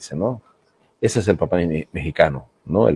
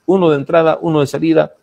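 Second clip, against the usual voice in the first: "Uno" raised high and punched on both phrases; emphatic rule; 6 semitones higher, faster than usual. Real, not acted. Teacher insisting to writers, emphatic stress on uno. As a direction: stress each "uno" hard, like a firm rule. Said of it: "stressing the rule to students".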